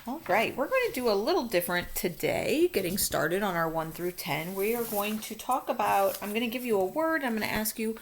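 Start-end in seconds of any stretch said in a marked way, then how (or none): none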